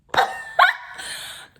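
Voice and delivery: a loud squealing laugh at herself; high shrieking laugh. Real, not acted. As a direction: let out a shrill laughing squeal. Laughing at her past self.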